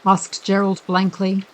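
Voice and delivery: usual speaking voice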